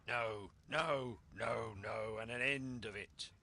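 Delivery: put-on furious old captain shouting no; angry cry. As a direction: slam the door on the argument. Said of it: "he cried once".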